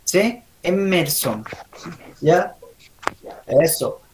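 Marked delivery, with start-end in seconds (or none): 0.6-1.4 "Emerson" with a held M; EMM-mer-son, falling; emphasised M. Shown as a demonstration. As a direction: lean on the M of Emerson. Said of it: "tiene que enfatizarse la M".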